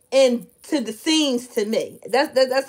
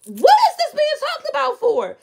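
exasperated outburst; 4 dB louder than usual. Real, not acted. Exasperated at party talk.

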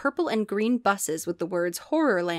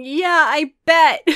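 usual speaking voice

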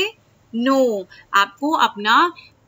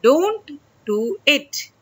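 slow drawn-out demo; 2 semitones higher, much slower than usual. Shown as a demonstration. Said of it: "तो".

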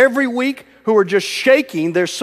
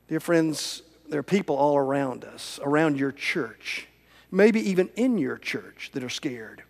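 much softer, lower pastoral appeal; hushed appeal; 4 semitones lower, 9 dB quieter than usual. Real, not acted. Preacher speaking softly to the congregation about the fearful. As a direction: draw them in close and quiet.

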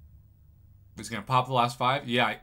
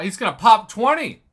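excited shout; 9 semitones higher, 12 dB louder than usual. Real, not acted. Commentator watching twenty six-star crystals pop, excited shout. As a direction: pitch jumps up, louder, thrilled.

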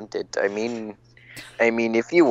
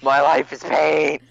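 mock wail; 5 semitones higher, 6 dB louder than usual. Voicing defendant dramatically.

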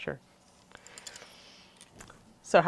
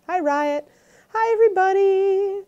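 sing-song voice; 13 semitones higher than usual. Real, not acted. Host greets chat viewers one by one, sing-song. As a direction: lilt it up high like a musical hello. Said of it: "There's my little sing-song voice".

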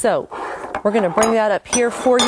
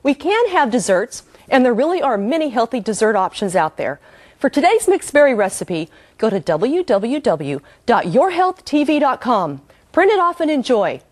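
TV host sign-off; 4 semitones higher than usual. Acted. Host's closing promo.

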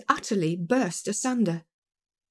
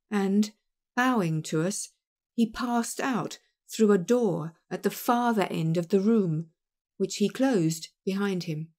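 usual speaking voice

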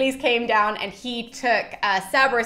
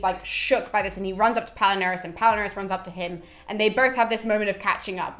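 normal talking voice

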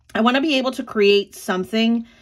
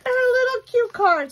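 much higher, delighted little voice; cute voice; 13 semitones higher than usual. Real, not acted. Showing her friend's cute card, cooing delightedly. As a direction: coo over the card like it's adorable.

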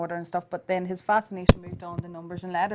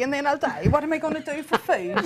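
higher, lightly voiced fretting child; worried child; 7 semitones higher than usual. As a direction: mimic the boy's anxious question. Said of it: "And then I just have a body".

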